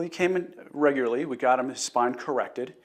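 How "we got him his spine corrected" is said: ordinary delivery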